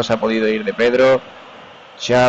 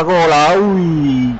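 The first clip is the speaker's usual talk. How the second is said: goal cry; 5 semitones higher, much slower than usual. Real, not acted. Near-goal cross in match.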